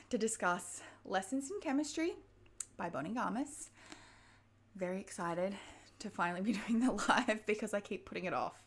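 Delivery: normal talking voice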